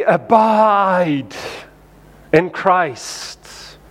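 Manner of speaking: long, drawn-out solemn preacher delivery; preacher's voice. Shown as a demonstration. Preacher urging a congregation all morning, mock solemn. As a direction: intone it slowly like a pulpit preacher. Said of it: "with, like, a preacher's voice".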